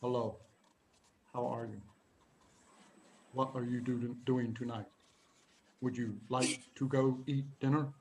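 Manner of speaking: soft, low, halting imitation of a young woman; hushed mimicry with long gaps between phrases; talking funny. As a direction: imitate her careful word-by-word speech, very quietly. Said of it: "she would talk like this".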